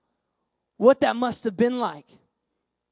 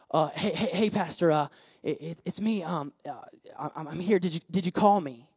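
put-on low, soft, stammering boy; hesitant boy; 6 semitones lower, 5 dB quieter than usual. Boy at his pastor's door at night, hesitant. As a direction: stumble over words, timidly checking in.

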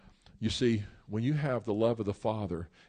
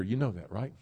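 ordinary delivery

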